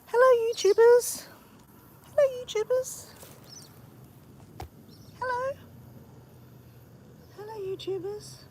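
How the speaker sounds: high-pitched greeting as a pet chicken; chicken's pretend voice. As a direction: squeak out a hello on the chicken's behalf. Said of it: "See you guys, say".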